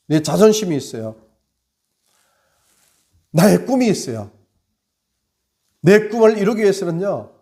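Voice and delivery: loud, high fervent sermon; impassioned Easter sermon climax; preaching at full force. Acted. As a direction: drive the message home like a revival preacher.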